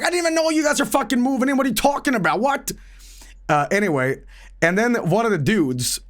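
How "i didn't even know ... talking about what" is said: pitched way up, fast and incredulous; frantic disbelief, high and rushed; exasperated high outburst. Retold or imitated. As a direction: blurt it out in disbelief, much higher. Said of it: "I'm like".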